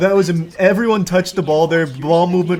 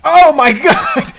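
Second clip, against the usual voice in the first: laughing shout; 5 dB louder, much slower than usual. Real, not acted. Huge play, laughing shout.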